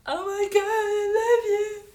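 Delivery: loud, high, drawn-out fangirl squeal; gushing fan. Pretend. Fan spotting the streamer in the street, mock hysterical squeal. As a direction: play a starstruck fan shrieking. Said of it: "you could just be like".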